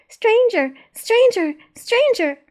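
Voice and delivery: put-on high child's yell. A narrator's reading. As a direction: yell it like a small child sounding an alarm. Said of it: "a small, clear, high-pitched voice yelled".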